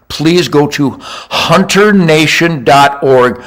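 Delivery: "Please go to hunter nation dot org" said slowly, each word weighted; slow earnest appeal to listeners. Acted. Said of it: "pleading plug".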